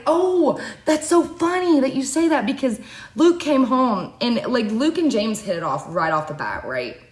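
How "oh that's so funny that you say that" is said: mom quoted. Retold or imitated. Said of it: "my mom's like".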